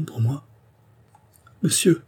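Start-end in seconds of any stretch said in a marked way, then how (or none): 1.6-2.0 high, mildly faltering address; anxious stammer. A narrator's reading. Andrée kneeling, anxious before the count, mild stammer. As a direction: utter it in a higher, uneasy voice. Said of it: "balbutia André".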